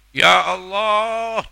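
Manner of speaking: high, loud, slow outcry; heartfelt cry. Shown as a demonstration. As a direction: shout it from the heart. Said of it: "Lalu teriak dengan hatinya". (teriakan keras dari hati)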